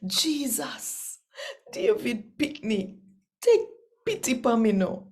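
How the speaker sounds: high, pleading call to a passing crowd; bawling out loud. A narrator's reading. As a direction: cry out for mercy over the noise. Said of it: "Him bawl out loud, loud".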